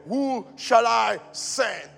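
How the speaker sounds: slow, weighty, solemn question; slow solemn voice of God; voice of the Lord. Retold or imitated. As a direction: intone God's call slowly. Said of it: "And I said".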